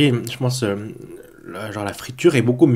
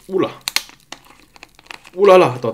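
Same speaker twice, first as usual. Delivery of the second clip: startled; 7 semitones higher, 5 dB louder, faster than usual. Real, not acted. Drink about to overflow.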